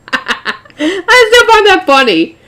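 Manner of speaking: laughing, high and drawn-out; amused laughter. Real, not acted. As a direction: squeal it slowly through real laughter. Laughing at the line just read.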